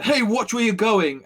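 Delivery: loud, high indignant shout; barked warning from a soldier; soldier's angry challenge. Acted. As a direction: snap at someone who bumped into you. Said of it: "he's like".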